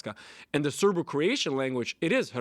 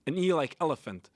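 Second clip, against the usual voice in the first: ordinary delivery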